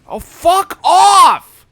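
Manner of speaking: slow, high, yelled in fury; furious shout. Real, not acted. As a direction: scream it out at the game. Player raging at game enemy.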